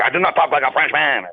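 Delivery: a friend's hammed-up French accent, denying it; imitating a friend's French accent. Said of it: "talks with a French accent".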